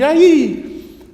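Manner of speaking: high, loud echo; echoed call. Acted. Valley answers the boy, echoing shout. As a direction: send the shout back like an echo. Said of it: "lá no fundo responde".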